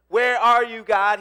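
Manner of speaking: loud, high cry to God; frustrated calling out. Acted. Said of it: "He's frustrated".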